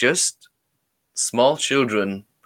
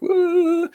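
put-on high child's wail; a small child's imitated cry; 3 dB louder than usual. Lost inner child wailing in the dark, high and thin. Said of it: "like, a crying, like a child".

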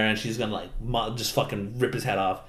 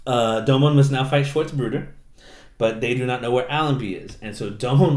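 usual speaking voice

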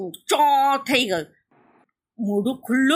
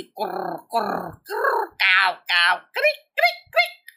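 angry crow noises; slower than usual. A narrator's reading. Crow mother on the nest edge, angry croaking. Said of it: "ગુસ્સામાં ડોક તીરછી કરીને".

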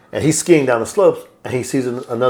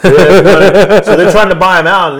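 laughing; 7 semitones higher, 6 dB louder, slower than usual. Real, not acted. Laughing while retelling plot.

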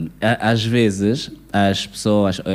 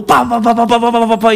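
high, rapid nagging babble; mock a girlfriend nagging on and on; 9 semitones higher, 3 dB louder than usual.

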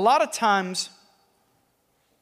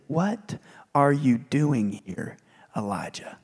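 slow whispered question from God; gentle whisper; 6 semitones lower, slower than usual. Acted. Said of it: "came a gentle whisper".

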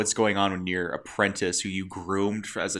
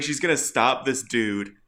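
ordinary talk, nothing marked

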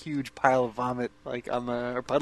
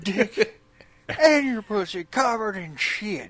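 slow, higher put-on voice; drunk's speech; 6 semitones higher than usual. Co-host quoting the puppet film's speech, drunken voice. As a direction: draw it out like a drunken movie speech.

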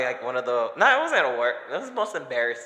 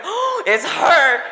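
much higher, louder, drawn-out gasp of fear; panicked fright; 11 dB louder, much slower than usual. Retold or imitated. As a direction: shriek it like you're caught. Caught by the door opening.

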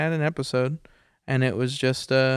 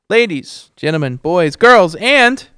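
loud showman call-out; announcer voice; 5 semitones higher, 10 dB louder, slower than usual. Pretend. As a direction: ringmaster announcing the crowd. Mock announcer sign-off.

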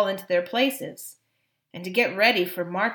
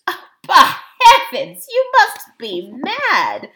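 loud, high exasperation; appalled, raised voice; outraged king; 10 semitones higher, 13 dB louder, slower than usual. A narrator's reading. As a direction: burst out in indignant disbelief. King exclaims at the dwarfs.

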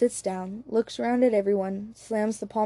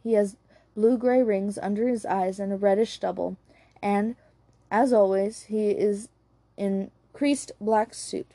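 ordinary delivery

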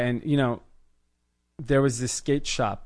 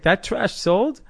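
high, louder, disbelieving question; mock incredulity; 9 semitones higher, 6 dB louder, slower than usual. Retelling the shop guy learning the clothes sold, incredulous. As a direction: imitate a critic who cannot believe it.

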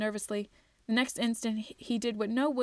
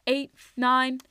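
yelling the count; 3 semitones higher, 7 dB louder than usual. A narrator's reading. Twig shouting the count while surrounded, loud. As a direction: bark the count as he's surrounded. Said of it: "he yelled out".

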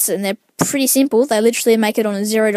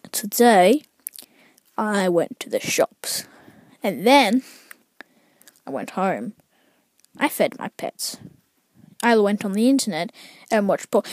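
deliberately plodding list, trailing down softer; dull diary recital; 2 semitones lower, 4 dB quieter than usual. Pretend. Podcaster mocking an over-detailed account of daily life, dull recital.

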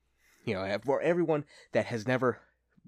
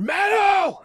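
mock metal yell; 12 dB louder than usual. Friend hyping the movie, screamed in a high bellow. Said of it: "he's over here going".

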